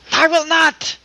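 loud, high mock-indignant refusal. Friend playing the wife refusing accents, mock indignant. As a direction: put on a hammed-up indignant voice. Mocking the wife's refusal to play.

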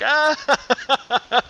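high "ah" bursting into staccato laughter; mock belly laugh. Joke teller plays the winning bartender, high mock laughing fit. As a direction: act out laughing your ass off. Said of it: "he's laughing his fucking ass off".

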